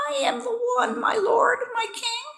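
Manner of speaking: very high tiny bee voice; small bee. A narrator's reading. Small bee confessing to the king, tiny high voice. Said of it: "A small bee flew straight to him and said".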